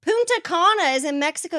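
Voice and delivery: high, loud exasperated correction; loud exclaim. Real, not acted. Arguing with a friend who insists, loud and exasperated. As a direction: shout the correction at her. Arguing loudly about geography.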